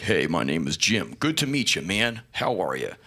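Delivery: low, easygoing small talk. Retold or imitated.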